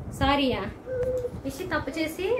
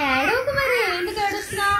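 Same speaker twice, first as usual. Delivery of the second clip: high coaxing; 6 semitones higher, 3 dB louder than usual. Real, not acted. Mother coaxing child to stop crying.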